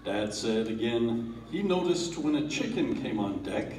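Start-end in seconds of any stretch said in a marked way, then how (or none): none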